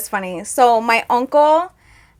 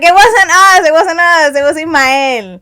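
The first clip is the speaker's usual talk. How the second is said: loud denial; 5 semitones higher, 6 dB louder than usual. Retold or imitated. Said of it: "we were like".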